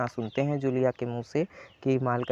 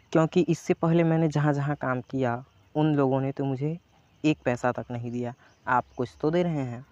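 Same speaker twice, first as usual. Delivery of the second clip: ordinary delivery, nothing marked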